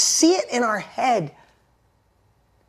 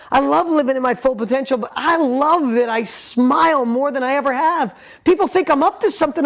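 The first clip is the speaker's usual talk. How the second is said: overjoyed; 5 semitones higher, 4 dB louder than usual. Acted. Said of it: "close your eyes and go".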